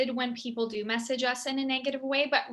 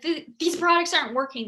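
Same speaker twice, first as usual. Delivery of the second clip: intense complaint; 4 semitones higher, 5 dB louder, faster than usual. Retold or imitated. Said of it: "be so intense".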